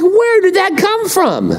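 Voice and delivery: high, slow startled question; mock bewilderment at sudden sin. Preacher voicing a startled believer, high and slow.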